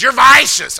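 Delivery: imitated raspy shout, high and loud; Ryan, real raspy. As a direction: holler it like a raspy teenager. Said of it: "He real raspy".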